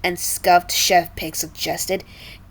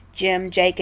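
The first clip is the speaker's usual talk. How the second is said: ordinary delivery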